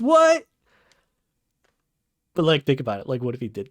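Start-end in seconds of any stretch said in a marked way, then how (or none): 0.0-0.5 high, loud shocked yell; surprised shout. Real, not acted. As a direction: bark it out in disbelief. Shouted disbelief.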